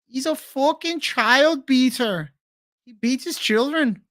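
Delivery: high, drawn-out mock Irish accent; bad Irish accent. Streamer trying Irish again for the chat, high wonky Irish accent. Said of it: "I don't know how that Irish accent flows".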